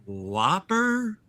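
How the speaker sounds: lightly imitated confused drunk; hesitant mock-drunk question at the drive-thru; drunk customer. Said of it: "'Cause they were so drunk".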